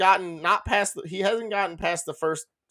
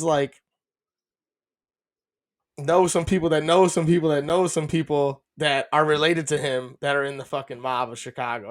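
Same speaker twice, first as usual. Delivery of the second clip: ordinary talk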